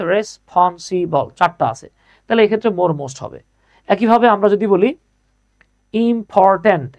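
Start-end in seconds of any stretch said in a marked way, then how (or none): 0.0-1.3 "responsible" slowly as res-pon-si-bol; "responsible" with a small gap after each syllable; split into syllables. Shown as a demonstration. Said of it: "ভাগ করেন তো কয়টা আছে". 5.9-7.0 "important" slowly as im-por-tant; "important" drawn out, syllable by syllable; split into syllables. Shown as a demonstration. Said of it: "এই যে দেখেন তিনটা আছে".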